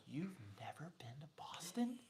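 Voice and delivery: hushed imitation of someone stunned, half whispered; mock incredulity. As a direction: play a boss who can't believe it. Retells CEO's disbelief.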